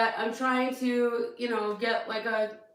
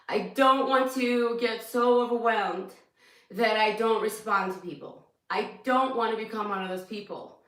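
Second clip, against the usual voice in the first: normal talking voice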